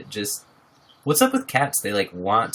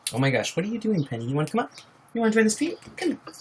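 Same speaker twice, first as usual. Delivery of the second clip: coaxing the cat; 9 semitones higher, 4 dB quieter, much faster than usual. Real, not acted. Coaxing the cat onto stream.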